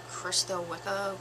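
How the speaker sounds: normal talking voice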